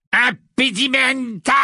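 high, slow shouted incantation; triumphant cry. A narrator's reading. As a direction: hurl the spell out, drawn out. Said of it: "Dans un cri de triomphe". (cri de triomphe aigu et étiré)

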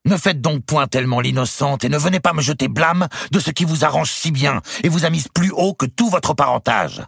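high, loud, ranting; furious. A narrator's reading. Louis rages at his queen, furious. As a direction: spit out the accusation. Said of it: "accroître sa fureur".

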